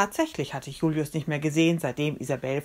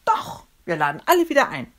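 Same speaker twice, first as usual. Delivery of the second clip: loud, higher defiant call; raised-voice insistence; 6 semitones higher, 6 dB louder than usual. Acted. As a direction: shout back in contradiction. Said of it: "rief ich".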